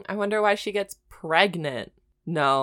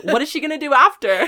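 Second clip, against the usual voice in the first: mock worry; 6 semitones higher, 8 dB louder, faster than usual. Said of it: "We're like".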